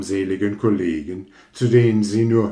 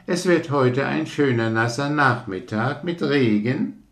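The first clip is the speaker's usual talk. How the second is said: higher frog voice; cheerful frog; 7 semitones higher than usual. Acted. Frog welcomes rocket to the ditch, cheerful. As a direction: greet the newcomer happily.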